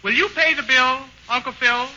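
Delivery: ordinary talk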